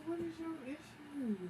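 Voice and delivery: like you can't believe she said it; incredulous. Retold or imitated.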